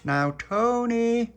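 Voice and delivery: long, high, loud singsong holler; shouted call. A narrator's reading. Little Pappy shouting through Tony's letterbox, a drawn-out call. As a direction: holler the name, stretching it right out. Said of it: "then shouted through the letterbox".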